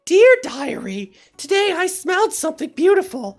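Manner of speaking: sweet and innocent. Acted. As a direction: coo it sweetly, all innocence. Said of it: "from bitter and evil to sweet and innocent".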